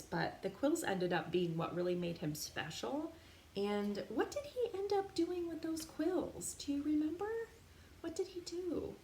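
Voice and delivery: plain reading voice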